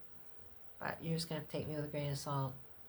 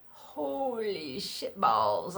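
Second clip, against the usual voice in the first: drawn-out rising awed curse; 3 semitones higher, 4 dB louder, much slower than usual. Retold or imitated. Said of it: "I was petrified".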